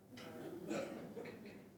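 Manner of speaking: short laugh after a quip. Real, not acted. Attendee quotes a colleague's quip to the room, laughing. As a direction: break off into a brief laugh.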